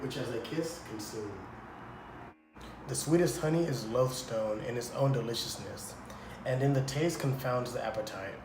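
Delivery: measured Shakespearean reading; a priest reciting verse to the couple; verse recitation. Acted. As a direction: declaim it like a solemn priest.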